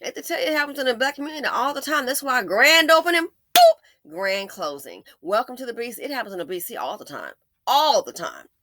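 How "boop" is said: very high, loud "boop" sound effect; squeaky high boop blip; cartoon boop. Real, not acted. Sound effect between opening and closing.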